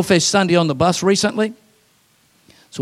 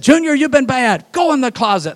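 stern parent; 7 semitones higher, 3 dB louder than usual. Retold or imitated. Parents punishing the boy.

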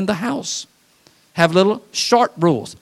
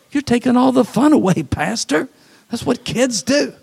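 high-pitched complaining parishioner; playful imitation of a protesting listener; mock protest; 7 semitones higher than usual. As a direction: object like a put-out parent, pitched up. Acts congregation objecting to pastor.

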